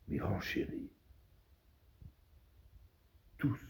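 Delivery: very soft, with long pauses; hushed oratory. Acted. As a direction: let the address sink to a near-whisper. Address drops to a hush.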